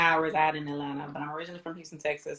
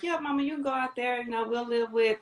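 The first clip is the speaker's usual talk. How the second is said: higher-pitched imitation of the kids; lightly voiced sons, higher and easygoing; sons reassuring mom; 5 semitones higher than usual. Voicing sons encouraging their mom.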